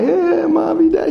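high-pitched imitation of a little one crying; crying baby. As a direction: mimic a child wailing for a parent. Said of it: "They cry".

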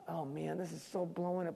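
softly muttered worry; worried aside. Retold or imitated. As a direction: fret quietly to yourself. Sitting anxious while they sing.

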